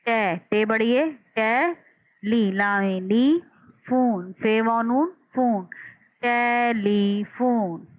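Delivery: टे, ली, फोन then टेलीफोन said very slowly; slow classroom spelling of टेलीफोन; syllable by syllable. Shown as a demonstration. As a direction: build टेलीफोन from its three sounds. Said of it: "तीन आवाज़ों में हो रहा है".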